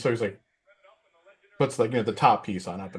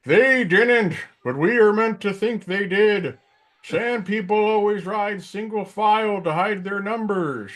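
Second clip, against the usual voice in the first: put-on much higher, slow, grumbling old-man Matthau voice; Walter Matthau impression; 11 semitones higher, 4 dB louder, slower than usual. Matthau as Obi-Wan explaining the Sand People, slow and high. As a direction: deliver it like a cranky old Matthau. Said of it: "his impression of Matthau as Obi-Wan".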